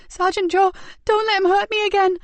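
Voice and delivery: put-on high, loud frightened boy's plea; terrified plea. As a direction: beg him for protection. Terrified boy pleads.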